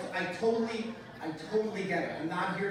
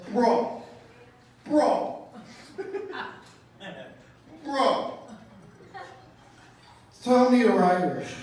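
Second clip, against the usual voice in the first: put-on loud, higher drunk bro; drunk guy yelling; 4 semitones higher, 7 dB louder, much slower than usual. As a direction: bellow it like a proud drunk at eleven in the morning. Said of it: "looks me up and down and goes".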